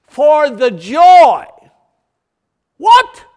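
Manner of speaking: loud, high, stretched-out preaching; fiery revival preacher at full pitch; preaching at full force. Real, not acted. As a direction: proclaim it to the whole church, drawing out key words.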